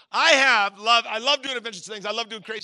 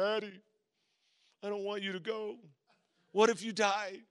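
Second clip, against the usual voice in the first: softly imitating a worried little boy; small, soft plea, a touch tearful; lightly voiced upset son; retold tearful child; 5 dB quieter than usual. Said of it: "he's kinda crying".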